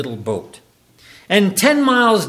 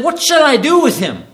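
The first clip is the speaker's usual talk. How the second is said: Pilate's cry; 8 semitones higher, 5 dB louder than usual. Retold or imitated. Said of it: "he said".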